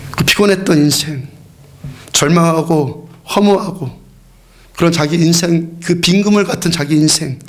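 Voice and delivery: usual speaking voice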